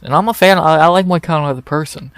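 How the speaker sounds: heated fan protesting mid-rant; emphatic protest. Real, not acted. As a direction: insist you really are a fan, heated. Ranting fan suddenly protests loyalty.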